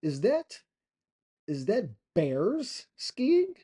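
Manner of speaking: high, halting mock astonishment; imitating an amazed, stammering bystander. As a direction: slowly puzzle it out in a put-on high voice. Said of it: "they'd be like".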